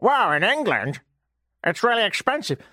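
light mock American accent; imitating an American officials' awe, American accent. Host imitating Metrolinx planners, mild American accent. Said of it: "Why am I doing an American accent".

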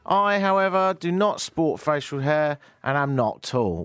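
high, loud presenter announcement; radio show opening. Acted. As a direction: project a grand welcome to listeners. Opens his radio show.

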